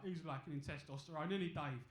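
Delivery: low, mocking pub lad voice; lightly mocking heckler.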